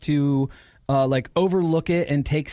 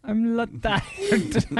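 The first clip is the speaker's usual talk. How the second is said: mock French; 7 semitones higher than usual.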